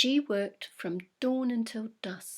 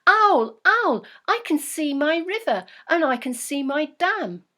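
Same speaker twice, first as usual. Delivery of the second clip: high, much louder call of delight; excited shout; 5 semitones higher, 12 dB louder than usual. Acted. Beaver shouting to owl from the sky, thrilled. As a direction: shout it out over the wind, thrilled. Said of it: "she shouted to Owl".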